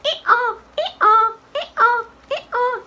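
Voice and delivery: put-on high, short girlish ee-aws; clipped high-pitched donkey calls; donkey bray. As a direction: bray high like a little girl. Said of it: "how the donkey say".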